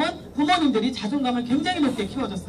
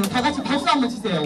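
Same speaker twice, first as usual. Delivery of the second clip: normal talking voice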